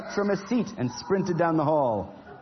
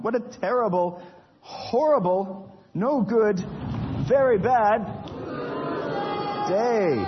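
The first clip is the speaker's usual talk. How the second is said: a hammed-up howl of misery; despairing lament; 7 semitones higher, much slower than usual. Repeated lament, louder.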